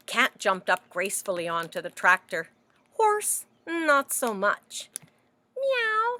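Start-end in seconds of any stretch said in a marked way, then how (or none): 5.6-6.2 high, put-on meow; storybook cat meow. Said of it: "said Cat".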